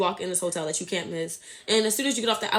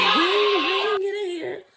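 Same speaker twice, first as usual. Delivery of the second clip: calling out for Wayne; 8 semitones higher, slower than usual. Retold or imitated. Retelling her calling for security.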